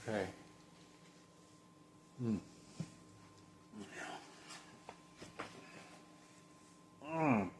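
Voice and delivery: sleepy yawn through the sounds. Real, not acted. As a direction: yawn it out mid-thought. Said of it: "Sorry about all that yawning".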